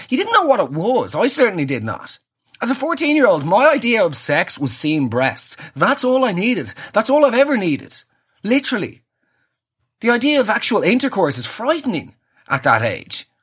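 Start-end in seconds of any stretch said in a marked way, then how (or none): none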